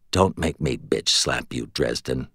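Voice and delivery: low, quiet growl of a threat. A narrator's reading. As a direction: growl it low and mock-menacing. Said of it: "Murphy growled".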